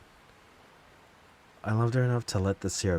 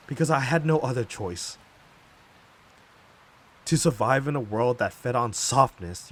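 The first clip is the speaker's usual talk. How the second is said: loud, raised-pitch indignant outburst; angry accusation; 4 semitones higher, 7 dB louder than usual. Acted. Wronged speaker accuses those who called them cruel, angry and defiant. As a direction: hurl the blame back at them forcefully.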